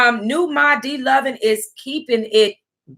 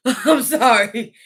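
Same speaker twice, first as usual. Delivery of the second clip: laughing apology; slower than usual. Real, not acted. Laughing apology after reaction.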